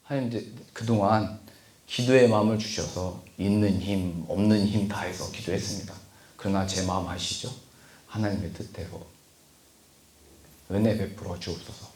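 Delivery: the right way to pray, hushed and humble; humble prayer. Shown as a demonstration. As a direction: pray gently, surrendering to God's will. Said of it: "하나님께 기도할 때도 이렇게 하는 거예요".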